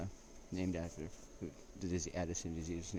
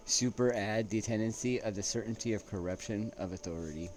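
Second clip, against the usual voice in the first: reading a quote aloud; 3 semitones higher, 6 dB louder than usual. Acted. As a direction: read it out like a solemn citation. Reading a quote by Lord Acton.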